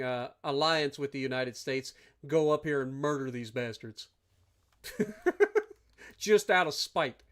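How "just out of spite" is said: high laugh, then slow words; chuckling, slowed spite; spiteful laugh. Real, not acted. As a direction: laugh at your own spite.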